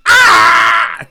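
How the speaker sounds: sudden loud high yelp of pain; imitated pain scream. Brother hit in the eye by icicle, screaming. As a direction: scream like ice just hit your eye. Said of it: "and he's like".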